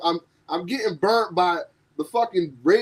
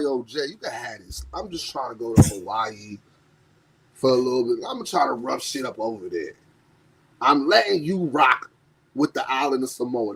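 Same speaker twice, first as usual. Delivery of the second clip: lower, laid-back big-boss tone; lowered Roman voice; 9 semitones lower than usual. Retold or imitated. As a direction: hand it over like a relaxed chief. Voicing Roman handing over power.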